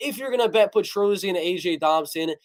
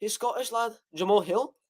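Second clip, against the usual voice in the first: lightly put-on Scottish accent, softer; 3 semitones higher, 4 dB quieter than usual. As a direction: imitate a Scottish lad questioning. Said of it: "That's a good Scottish accent".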